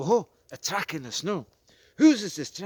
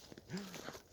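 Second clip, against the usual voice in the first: quick surprised intake of breath; sharp gasp; 9 semitones higher, 13 dB quieter than usual. Acted. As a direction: gasp at the discovery. Sudden discovery.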